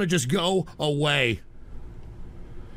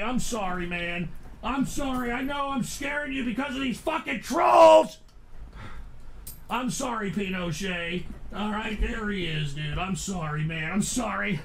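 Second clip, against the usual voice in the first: soft, apologetic murmur; gently calm a frightened dog; soothing the dog; 6 dB quieter than usual. Real, not acted. Owner comforting his scared dog Pinochet, soft and sorry.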